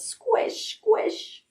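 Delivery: breathy high whispered squeak; whispered toy squeak. Acted. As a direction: squish it out like a muddy squeaker. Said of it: "all he could say was".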